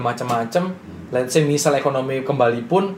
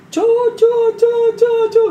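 mock mom calling; 6 dB louder than usual. Mom crying, calling son.

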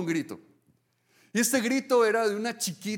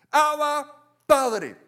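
raised loud call of Abba, Padre; crying out; 7 semitones higher, 6 dB louder, much slower than usual. Pretend. Believers crying out to their Father, a loud call. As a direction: call out to a father, higher and louder.

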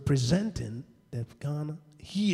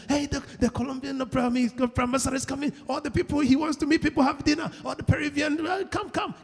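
acted excited call, pitched up; mock crowd buzz, higher voice; excited announcement; 8 semitones higher than usual. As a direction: hurriedly summon everyone to gather. Acts people excitedly gathering round ambassador.

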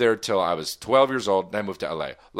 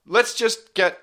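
calling out; 12 semitones higher, 4 dB louder, much slower than usual. Real, not acted. [name] calls out to listeners.

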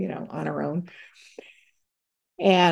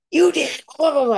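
mock outraged yell, higher and louder; half-hammed angry teen outburst; outraged daughter; 10 semitones higher, 6 dB louder than usual. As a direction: fire it back like a stung teenager. Angry daughter confronts mother.

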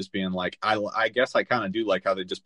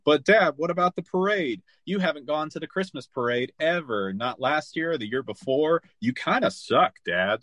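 higher-pitched imitation of a reproachful child; complaining kid; 6 semitones higher than usual. Kid scolding his dad about missing the parade, mock reproachful child voice. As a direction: voice a let-down son, pitched up. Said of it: "And his kid, though".

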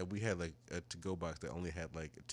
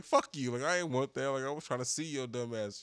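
loud, raised-pitch mock outburst; retold annoyance; 6 semitones higher, 6 dB louder than usual. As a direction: act out an exasperated comeback. Retold angry reply to date.

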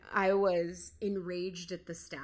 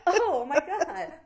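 loud, laughing oh my God; real loud laughter; 7 semitones higher, 11 dB louder, slower than usual. Real, not acted. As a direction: crack up mid-line. Laughing at own joke.

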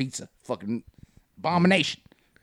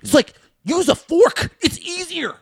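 Seinfeld rant; 6 semitones higher than usual. Retold or imitated. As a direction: rant like a comic about chopsticks. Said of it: "the Seinfeld comedy".

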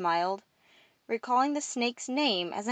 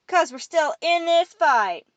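slow, loud, high chant; shouted response; 9 semitones higher, 8 dB louder than usual. A narrator's reading. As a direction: chant it back as one crowd. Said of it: "they sounded the response".

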